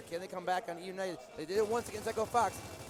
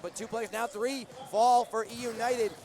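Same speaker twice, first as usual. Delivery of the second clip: excited; 5 semitones higher, 7 dB louder than usual. Real, not acted. Caster calls a big play.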